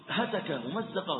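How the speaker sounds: usual speaking voice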